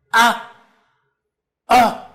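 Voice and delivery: high, loud furious cry; angry roar. Acted. Nimrod roaring in fury at the news: a loud high yell. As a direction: let out a raging yell.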